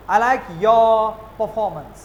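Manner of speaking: very slow, r silent in your performance; slow British model. Shown as a demonstration. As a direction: model it slowly without the r. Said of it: "मैंने बोला". (धीरे धीरे बिना आर के British लहजे में)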